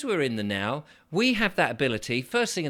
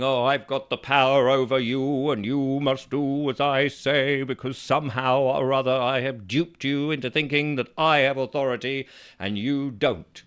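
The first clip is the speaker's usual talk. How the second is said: puffed-up official voice, louder; mocking pompous authority; 4 dB louder than usual. Mocking a threatening letter, pompous and loud. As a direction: lord it over them like a bossy letter.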